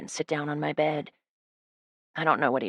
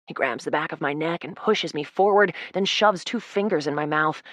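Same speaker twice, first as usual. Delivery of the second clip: ordinary delivery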